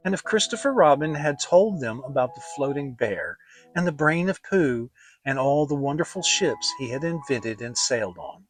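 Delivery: ordinary delivery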